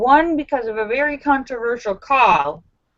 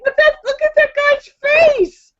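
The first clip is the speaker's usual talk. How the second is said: very high, loud pleading with her sweetie; pleading plea; 5 dB louder than usual. Retold or imitated. Vlogger pleading with her sweetie over the player, very high and loud. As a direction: beg him to feel for the sad player. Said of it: "But I was like".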